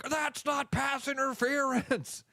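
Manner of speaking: high, drawn-out outraged holler; mock fan protest. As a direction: imitate a fan protesting the flag. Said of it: "then the exact opposite happens".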